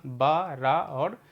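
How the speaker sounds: very slow spelling of each syllable; teacher reads the jumbled letters to children, very slowly. Shown as a demonstration. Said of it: "लिखा हुआ बड़ा शाह".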